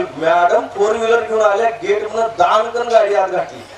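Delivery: ordinary talk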